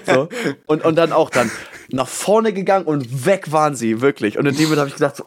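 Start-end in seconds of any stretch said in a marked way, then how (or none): none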